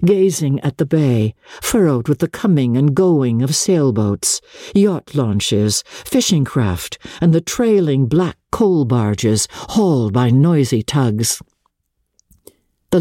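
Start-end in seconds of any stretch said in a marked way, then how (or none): none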